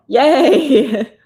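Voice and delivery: loud, high little yay; excited cheer. Real, not acted. Cheering a viewer who is listening at work, with a loud yay. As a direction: cheer them on.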